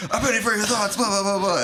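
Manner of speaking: high-pitched mock assassin's cry; comic high-voiced proclamation; mock yell. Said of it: "had planned to yell his carefully chosen catchphrase".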